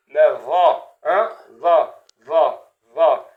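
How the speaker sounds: le bon va, arrondi; corrected va. Shown as a demonstration. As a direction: give the right va, not the flat one. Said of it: "Mais pas va".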